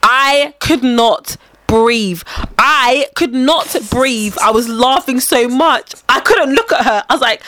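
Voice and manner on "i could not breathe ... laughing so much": "could not" stretched word by word; slow, raised, heavily punched "could not breathe"; drawn-out emphasis. Real, not acted. Dramatic emphasis on helpless laughter.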